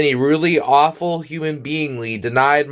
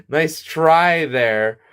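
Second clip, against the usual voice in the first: very slow, drawn-out "Nice try there"; slow, savoring mockery; slow taunt; much slower than usual. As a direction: stretch it out to rub it in. Repeated slower as a taunt.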